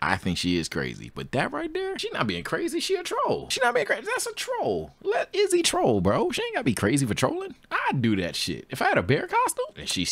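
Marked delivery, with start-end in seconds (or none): 1.1-7.5 high, fast, animated arguing; high-pitched excited insistence; excited defense. Real, not acted. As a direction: insist she's just trolling. Defending Izzy excitedly to chat.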